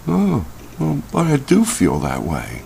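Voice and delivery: put-on a child quietly protesting to a parent; meek kid reply. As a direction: shrink back like a scolded child. Kid answering back to parent.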